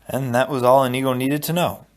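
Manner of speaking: ordinary delivery, nothing marked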